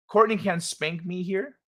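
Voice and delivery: "Courtney can spank me here?" lifted at the end; read as a question. Shown as a demonstration. Streamer reads a comment aloud, "here" rising into a question. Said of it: "there was a question mark at the end".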